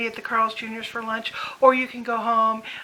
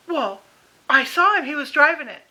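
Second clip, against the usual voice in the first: imitating demanding mother; 3 semitones higher, 7 dB louder than usual. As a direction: play the confused mother insisting. Mother insisting she saw him.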